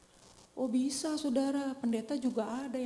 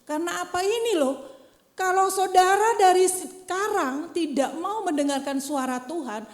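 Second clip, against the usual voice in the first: loud, higher fervent sermon; preaching at full force; 4 semitones higher, 6 dB louder than usual. Acted. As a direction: drive it home to the church. (berkhotbah dengan penuh semangat)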